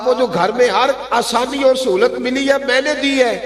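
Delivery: high, reproachful uncle reminding; taunting uncle. Acted. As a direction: rub in every favour you gave him. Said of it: "کہا".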